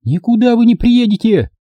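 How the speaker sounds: put-on high scolding older woman. A narrator's reading. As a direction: snap in as a nagging older woman. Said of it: "Бестактно вмешалась баба постарше".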